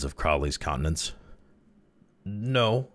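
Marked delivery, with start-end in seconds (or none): 2.2-2.9 higher, nervous one-word denial; jerked out. A narrator's reading. Crawley denies the spotter's accusation, jerked out. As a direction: blurt it out, higher. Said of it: "jerked out Crawley".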